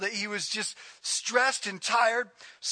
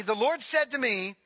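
ordinary talk, nothing marked

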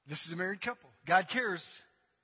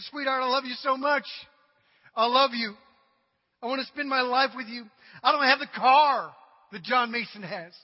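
pleading suitor; 4 semitones higher, 7 dB louder than usual. Acted. Young man proposing in joke.

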